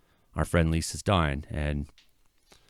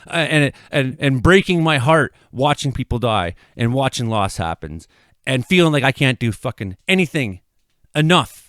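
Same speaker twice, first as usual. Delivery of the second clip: higher and louder, anguished venting; frustrated raised voice; 6 semitones higher, 4 dB louder than usual. Real, not acted. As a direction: let the frustration pour out loud. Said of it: "I'm screaming at it".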